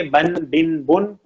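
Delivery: teacher's model of بن with a, i, then u; each بن ends on a clear n; tanween drill. Shown as a demonstration. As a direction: say بن as ban, bin, bun. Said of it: "تنوین کی sound ہوتی ہے".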